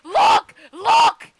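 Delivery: high, loud, urgent yells; frantic shouting. Real, not acted. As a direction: shriek orders at your teammate. Urging teammate during frantic game moment.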